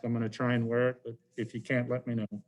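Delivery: ordinary talk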